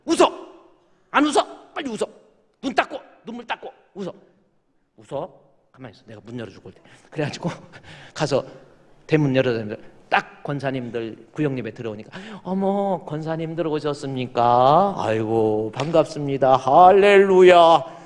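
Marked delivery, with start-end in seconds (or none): 0.1-6.8 high, tense, hissed orders; hushed threat. Retold or imitated. Abusive husband hissing orders at his crying wife. 12.4-18.0 slow, syrupy greeting ending in a loud drawn-out hallelujah; fake-holy welcome. Husband greeting visiting deaconesses, hammed-up holy welcome. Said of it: "할렐루야가 나오겠지".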